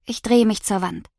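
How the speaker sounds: usual speaking voice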